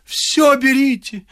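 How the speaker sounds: slow, high wailing plea; slow mock lament; repeated lament. A narrator's reading. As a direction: drag it out mournfully. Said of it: "повторила вдова".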